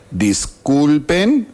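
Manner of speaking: ordinary talk, nothing marked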